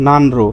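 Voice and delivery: "নান রো" split, clear N then R; letter-by-letter reading. Shown as a demonstration. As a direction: keep both sounds in "নান রো". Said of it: "স্বাভাবিকভাবে উচ্চারণ করলে কি হতো". ("নান রো" আলাদা ন ও র দিয়ে)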